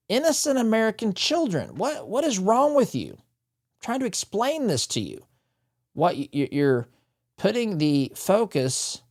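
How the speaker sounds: high-pitched, incredulous exasperation; exasperated rebuke. Retold or imitated. Host voicing an official's rebuke to the media, exasperated.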